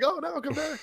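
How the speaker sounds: high-pitched comic call; mock pleading. Owner calling after fleeing cat, mock pleading.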